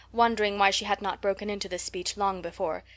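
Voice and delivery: ordinary delivery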